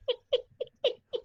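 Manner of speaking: high-pitched laugh bursting out; high burst of laughter. Real, not acted. Laughing at his own story, high-pitched laugh.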